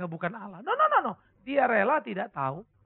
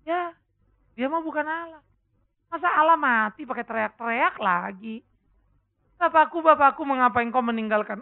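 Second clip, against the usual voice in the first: mocking neighbour; 4 semitones higher, 6 dB louder than usual. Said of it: "saudara-saudara kita tetangga bilang".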